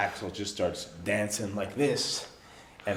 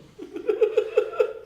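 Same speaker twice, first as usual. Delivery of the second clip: high burst of laughter; 5 dB louder than usual. Real, not acted. Teller laughs at story.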